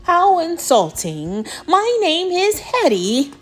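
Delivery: mildly indignant monster, rising high; angry monster. Acted. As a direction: take offence as a huffy monster. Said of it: "glared down with the angriest growl".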